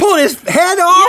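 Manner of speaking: high, loud, astonished question; incredulous shout. Real, not acted. Co-host repeating the shocking news, incredulous shout. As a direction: echo it back in loud disbelief.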